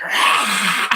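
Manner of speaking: a laughing, hammed-up roar; mock monster roar. Imitating the wife breaking through the door with a playful laughing roar. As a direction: growl like a beast while laughing.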